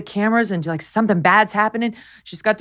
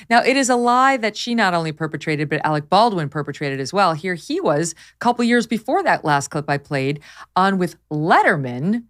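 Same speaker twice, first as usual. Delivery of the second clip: ordinary talk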